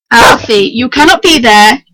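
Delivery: loud scolding shout at the dog; screaming at the dog. Real, not acted. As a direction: yell at the dog to get off. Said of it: "when I'm screaming like this".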